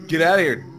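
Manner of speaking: ordinary delivery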